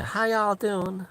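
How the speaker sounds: high-pitched, slow Southern greeting; Southern girl impression. Brandy greeting customers at the pizza place, high slow drawl. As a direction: draw the hello out high and slow.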